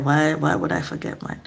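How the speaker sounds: ordinary delivery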